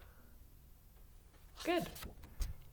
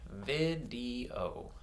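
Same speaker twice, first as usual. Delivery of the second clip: VIDEO softer, in three separate syllables; counted syllables; 5 dB quieter than usual. Shown as a demonstration. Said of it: "clap them out".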